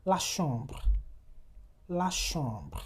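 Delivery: chambre with nasal vowel, no m; French nasal example. Shown as a demonstration. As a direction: model la chambre twice. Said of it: "You have letters".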